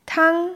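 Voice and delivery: Tang held level and high; first tone. Shown as a demonstration. (Tang im ersten Ton, hoch und gleichbleibend)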